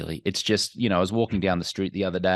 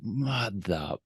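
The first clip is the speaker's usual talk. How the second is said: muttered curse; 5 dB quieter than usual. Retold or imitated. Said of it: "As opposed to now where I'm like".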